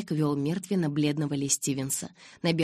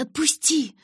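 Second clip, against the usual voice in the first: shout; 11 semitones higher, 6 dB louder, slower than usual. A narrator's reading. Bella to Edward carrying her, yelling to let go. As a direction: pitch leaps high, loud cry of отпусти. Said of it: "закричала я".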